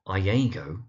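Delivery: Iago said eye-AY-go; wrong Iago, rhyming its middle with "day"; mispronunciation. Shown as a demonstration. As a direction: say Iago with "eye", then a long AY. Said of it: "you do find mispronunciations such as".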